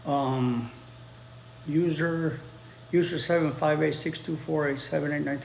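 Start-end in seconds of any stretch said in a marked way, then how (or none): none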